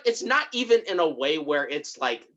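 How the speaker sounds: ordinary delivery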